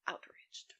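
breathy stage whisper. Real, not acted. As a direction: drop to a sudden whisper on outraged. Whispered punchline after loud build.

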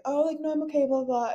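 high, lightly imitated brush-off; nervous refusal. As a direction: mimic a shy fan declining. Voices the nervous sister declining.

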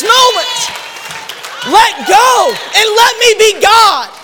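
high, loud, slow preaching cry; God's fervent plea. Acted. God urging the struggling believer to receive forgiveness, loud and high. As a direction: plead with all force, drawing each word out.

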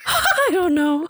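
high, slow laughing reply. Real, not acted. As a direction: giggle through the words. Laughing while mocking partner.

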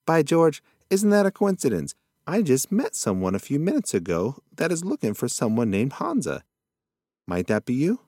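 put-on a muddled old possum delighted; lightly voiced old possum. Possum marvels at coincidence.

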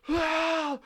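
sudden high yelled "Ah!"; retelling a stranger screaming outside; mock scream. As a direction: let out a loud, high startled scream. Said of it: "like, top of your lungs".

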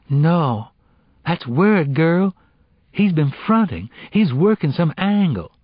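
put-on high, emphatic street-talking girl; insistent teenage friend. Girl warning her friend about a scheming boyfriend, insistent.

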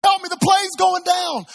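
very high, loud cry for help; panicked scream. Retold or imitated. Passenger begging the control tower for help, panicked scream. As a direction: scream for help as if about to crash. Acted passenger's mayday over the radio.

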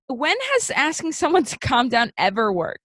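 high-pitched incredulous rhetorical question; exasperated. Real, not acted. Host ranting about the librarian, exasperated. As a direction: push it out in exasperated disbelief.

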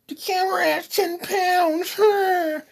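high, slow put-on voice; mock high voice. Mocking the saying about the camera in a high slow voice. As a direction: drag it out in a squeaky mocking tone.

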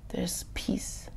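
very soft, near-whispered reassurance; hushed peace. Shown as a demonstration. Preacher promising peace amid a busy mind, hushed. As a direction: drop right down to a gentle hush.

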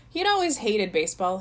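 usual speaking voice

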